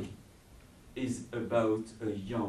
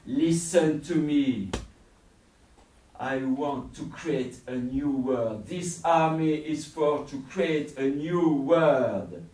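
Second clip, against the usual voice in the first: ranting villain; 6 semitones higher, 15 dB louder than usual. Acted. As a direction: build to a roaring threat. Villain seizes him, rants.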